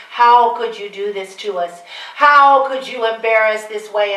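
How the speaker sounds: loud wailing rebuke; screaming and wailing. Retold or imitated. Mother wailing at Mary over shameful news, screaming. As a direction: wail it at your daughter, scandalized. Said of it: "she started screaming and wailing".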